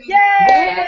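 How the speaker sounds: high, loud celebratory yay; cheering yay. Acted. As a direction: cheer it out like a win. Group cheer after laughing.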